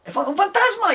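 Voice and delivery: high stammering cry of fright; scared yell. Retold or imitated. People fleeing a cartoon ghost, high frightened cry. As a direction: shriek as you run from a ghost. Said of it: "la gente salía corriendo".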